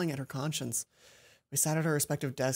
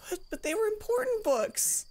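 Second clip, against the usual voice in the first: put-on much higher girl's voice, defensive; flustered Mai; 11 semitones higher than usual. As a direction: Mai stammering her excuse.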